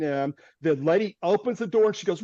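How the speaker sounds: ordinary talk, nothing marked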